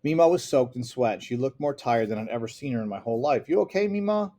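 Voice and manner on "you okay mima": put-on much higher, worried little girl voice; a scared girl checking on grandma; anxious child.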